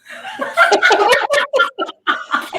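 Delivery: loud, high burst of laughter; real laughter. Real, not acted. Cracking up with co-host over own joke, loud laughter.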